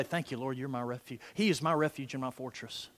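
low, hushed thanks to God; quiet personal prayer. Acted. Believer praying in a corner before service, quiet prayer. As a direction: pray it privately under the breath.

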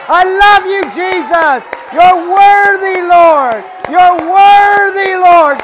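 shouted high and loud, full voice; loudest praise shout. Real, not acted. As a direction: cry out praise to the Lord at full power. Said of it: "we're gonna speak in our loudest voice and tell God how good He is".

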